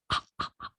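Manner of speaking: whispered catch-and-release in the throat; unvoiced glottal ah; glottal stop demo. Shown as a demonstration. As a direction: close the throat and open it, no voice. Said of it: "something like that".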